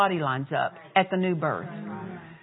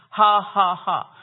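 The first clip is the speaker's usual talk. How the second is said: louder forced ha ha ha; put-on laugh; 6 dB louder, slower than usual. Preacher demonstrates the laugh against the devil, forced laugh. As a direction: push out a defiant fake laugh. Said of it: "I was putting on a laugh, just like this".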